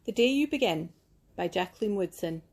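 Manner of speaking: ordinary delivery, nothing marked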